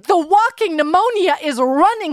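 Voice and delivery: high, worked-up comic exasperation; flustered bewilderment. Real, not acted. Host baffled by Trump's comeback, high and flustered.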